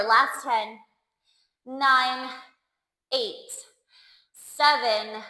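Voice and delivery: ordinary talk, nothing marked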